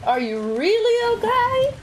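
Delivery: gently concerned, high and slow. Real, not acted. Checking on a viewer.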